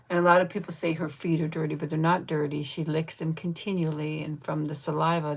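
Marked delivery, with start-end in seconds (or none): none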